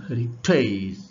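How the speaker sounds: heritage with a faint start and a forced, stretched second half; second-syllable stress. Shown as a demonstration. Teacher reading why an is used, heritage stressed after its first syllable. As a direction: push hard on the latter part of heritage. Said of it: "শেষের সিলেবলে জোর দেয়া".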